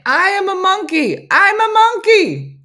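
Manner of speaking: high, loud retold yell; mock-wail it at full volume; imitated yelling. Retelling the brother sleepwalking into the tub, mock yelling. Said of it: "started yelling and crying, saying".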